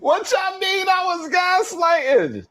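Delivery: high, outraged cry of disbelief; indignant shout. Real, not acted. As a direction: shout it like you can't believe the accusation. Streamer accused of gaslighting.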